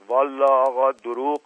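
deliberate, unhurried. A narrator's reading. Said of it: "مشقاسم با تأنی گفت".

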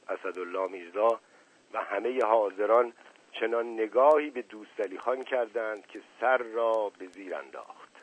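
usual speaking voice

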